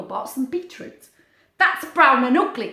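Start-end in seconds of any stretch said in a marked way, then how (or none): 1.6-2.7 louder scornful duck; mock disgusted quack; disdainful duck. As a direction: sneer at the beetroot. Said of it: "quacked the duck".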